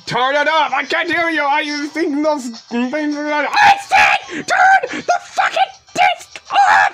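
loud, high, furious yelling; yelling over noise. Real, not acted. Can't hear over the DVD, furious shout to turn it off. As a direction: shout over the blaring music.

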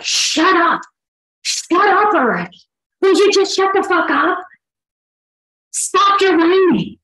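high, loud exasperated shouts; angry yelling. Retold or imitated. A fed-up parent yelling at a defiant nine-year-old. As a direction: snap at the kid to shut up.

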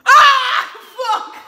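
sudden loud high yell of pain; real cry of pain. Real, not acted. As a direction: yelp as the strip rips. Wax strip ripped off.

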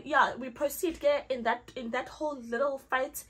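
ordinary talk, nothing marked

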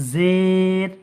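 জের with a long, level, stretched vowel; drawn-out vowel. Shown as a demonstration. Teacher showing how to read the word, drawn-out জের with a z. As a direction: hold the middle of জের. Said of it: "একটু টান দিয়ে পড়তে হবে".

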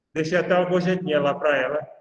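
normal talking voice

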